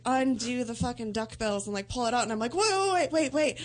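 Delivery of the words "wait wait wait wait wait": high, urgent panicked wait wait; a retold pleading panic, pitched high. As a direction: beg him to stop, voice jumping up. Panicking as doctor pulls out.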